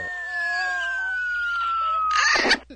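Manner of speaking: high drawn-out comic wail; long, high fading cry; long falling scream. Pretend. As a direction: scream as if plummeting away. Nigel tumbles away mid-call.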